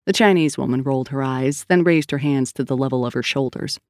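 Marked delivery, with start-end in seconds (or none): none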